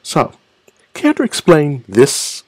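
high, slow mock-teacher questioning. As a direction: demand an explanation like a pretend teacher. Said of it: "The teachers would be like".

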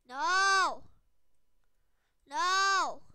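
short, loud cries of no; dismayed shouting. Real, not acted. Calling out in dismay during game.

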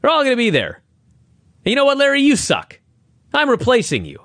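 mock Russo promo. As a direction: ham up the boss announcing big changes. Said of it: "Russo says".

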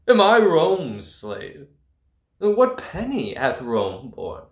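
loud, high, derisive shout; scornful laughing outburst. Acted. Laughing outraged retort.

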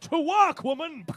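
hammed-up shout at the wife; mock possessive bark. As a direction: roar an order like a caveman husband. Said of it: "Possessive, Tom".

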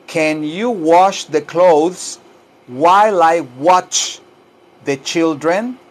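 slow, drawn-out English for repeating; slow teaching model. Shown as a demonstration. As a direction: model the sentence slowly, word by word. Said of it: "también puedo decir así, miren". (inglés lento para repetir)

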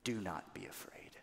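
whispered, soft calm; hushed reassurance. Acted. Moses whispering comfort to the scared Israelites. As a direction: breathe it out to calm a frightened crowd. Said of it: "and he says".

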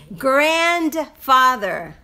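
GRANDFATHER muy lento, alargando "GRAND"; pronunciación modelo. Shown as a demonstration. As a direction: separa GRAND y FATHER con gr marcada en GRANDFATHER. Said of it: "No es, no es verde". (GRANDFATHER muy pausado, "GRAAAND-fáder")

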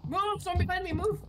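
high, frantic yell; panicked shout. Real, not acted. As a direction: scream at them to move. Panicked by something behind.

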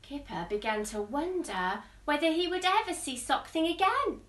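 usual speaking voice

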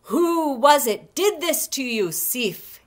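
loud, high outraged demand; mighty rage. A narrator's reading. Thor to his shorn wife, in mighty rage. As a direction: thunder it out like a wronged god. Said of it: "he grew into a mighty rage".

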